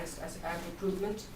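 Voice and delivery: usual speaking voice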